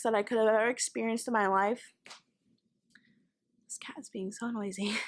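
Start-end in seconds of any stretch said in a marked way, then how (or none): 3.7-5.0 real whispered complaint, breath only; whispered aside. Real, not acted. Speaker complains about the noisy cat, whispering. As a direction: whisper it off to the side.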